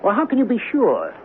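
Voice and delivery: frightened husband in the storm; panicky. Acted. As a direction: voice jumping up in alarm.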